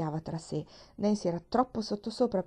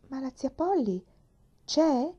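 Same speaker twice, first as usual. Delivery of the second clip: anxious question; 6 semitones higher, 4 dB louder, slower than usual. Acted. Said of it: "chiese la bambina con ansia".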